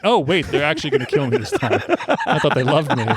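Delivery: lightly hammed-up dismay; mock dawning realisation. Host voices the prisoner for a laughing co-host, mock dismay. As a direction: play a condemned man suddenly catching on, half joking. Acting out condemned man's late realisation.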